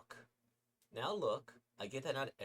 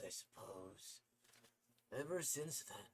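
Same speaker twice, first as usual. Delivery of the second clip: ordinary delivery, nothing marked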